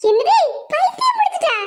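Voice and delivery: high-pitched disbelief; shocked question. Acted. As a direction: echo it back in high alarm. Bystander shocked by the news.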